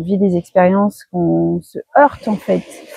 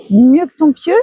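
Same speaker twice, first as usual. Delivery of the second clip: higher, louder, questioning her own slip; amused surprise; 6 semitones higher, 4 dB louder than usual. Real, not acted. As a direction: catch the slip and repeat it, amused.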